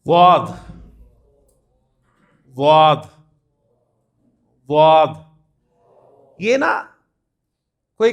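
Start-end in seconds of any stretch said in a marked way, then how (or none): 0.0-5.2 واد said with the Arabic daad, tongue edge to the back molars; Arabic letter daad. Shown as a demonstration. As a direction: hold واد as a deep, emphatic daad. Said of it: "اس کی pronunciation سب سے مشکل ہے".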